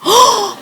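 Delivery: a sharp, high gasp of shock; startled gasp. Retold or imitated. Asked about her dream, a startled gasp. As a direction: gasp as if caught off guard. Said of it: "너무 무섭지 않아".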